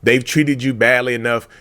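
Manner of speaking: ordinary talk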